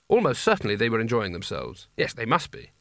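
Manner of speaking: plain reading voice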